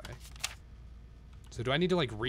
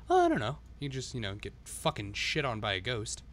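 ordinary talk, nothing marked

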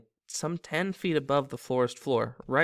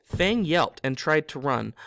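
ordinary delivery, nothing marked

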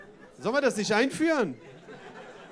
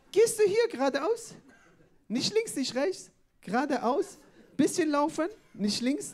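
higher voice, each phrase lilting upward; chopped, rising phrases in a put-on higher voice; imitated passerby giving directions; 7 semitones higher than usual. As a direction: mimic a helpful stranger's simple step-by-step directions. Imitating foreign passerby's halting directions.

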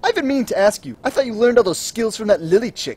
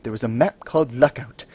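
epic fantasy narrator; 4 semitones lower than usual. Acted. Legend told as epic tale.

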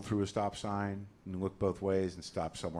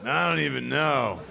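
put-on high, slow, louder resigned kid; weary kid; 7 semitones higher, 5 dB louder, slower than usual. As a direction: sigh it out like a burdened little caregiver. Kid weary about old dad.

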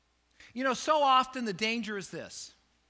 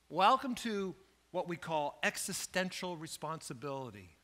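normal talking voice

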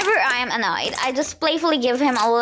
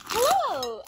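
cheery greeting; 9 semitones higher, 4 dB quieter than usual. Retold or imitated. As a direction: burst in as the excited best friend. Best friend bursts into the classroom.

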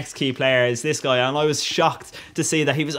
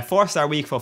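normal talking voice

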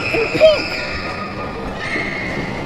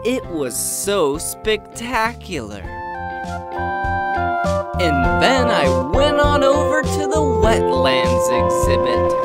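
voiceover narration; 5 semitones lower, 5 dB quieter than usual. A narrator's reading.

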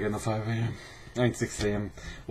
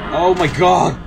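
startled; 7 semitones higher, 8 dB louder than usual. Real, not acted. Startled by the chicken.

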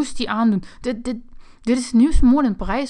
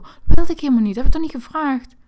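loud, higher whining complaint; a spoiled kid protesting to mom; louder and higher, aggrieved whine; whiny outburst; 5 semitones higher, 11 dB louder than usual. Acted. Complaining about the gift.

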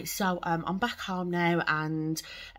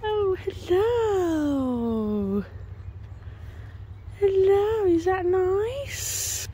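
very high, drawn-out pet talk; 12 semitones higher, much slower than usual. Real, not acted. Greeting a horse over the fence, high slow cooing baby talk.

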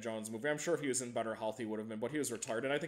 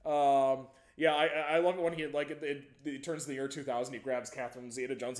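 usual speaking voice

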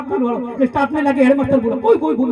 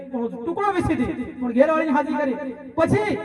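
ordinary delivery

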